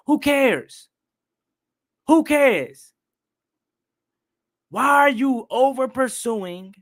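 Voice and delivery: high-pitched fan yelling advice at a player; exasperated scolding. Retold or imitated. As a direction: berate the player as if he could hear you. Retold scolding of player from the couch.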